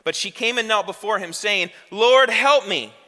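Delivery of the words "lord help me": high, loud, very slow cry for help; desperate plea. Acted. Kneeling mother begging Jesus for her daughter, loud desperate plea. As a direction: beg him with everything.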